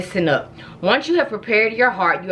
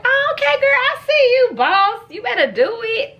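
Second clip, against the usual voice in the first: put-on high, loud hype-girl cheer; hyped-up cheering; 13 semitones higher, 3 dB louder than usual. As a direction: cheer her on like a proud best friend. Mock-cheering mom's new word.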